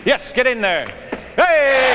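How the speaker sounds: loud high cheer of delight. Real, not acted. Comic cheering his volunteer's shot, loud and high. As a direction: shout it out as the ball drops.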